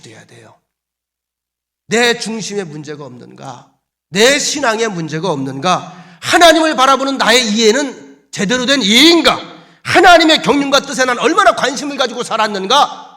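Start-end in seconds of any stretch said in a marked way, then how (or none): 1.9-13.1 rhetorical questions building loud and high; forceful preaching. Acted. Preacher has believers examine their own faith, exhorting. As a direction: press each self-searching question harder.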